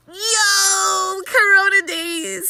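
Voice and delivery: high, loud crying wail; dramatic loud lament; wailing exclaim. Real, not acted. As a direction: wail it out, half-laughing.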